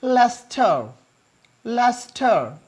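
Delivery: लेस्टर said as lester, middle syllable dropped; लेस्टर, two syllables only; correct pronunciation. Shown as a demonstration. Teacher correcting how Indians say Leicester. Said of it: "that is".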